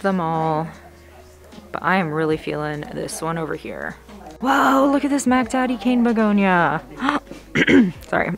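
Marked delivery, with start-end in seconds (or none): 4.4-6.8 loud, high burst of delight at a huge plant; real thrilled exclaim, up and loud; real excitement on spotting it; excited. Real, not acted. Spots a huge begonia. 6.9-8.0 gasp. Real, not acted. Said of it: "choked myself".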